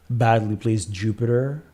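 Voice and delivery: usual speaking voice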